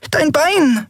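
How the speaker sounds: very high little peep; small Nelen worries about Kero's leg, squeaking high; squeaky pipe. A narrator's reading. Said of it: "piepste Nelen".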